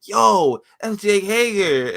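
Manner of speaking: loud, high excited crowd yell; hyped. Retold or imitated. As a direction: shout it out like a hyped fan in the crowd. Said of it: "we were all hyped in the crowd".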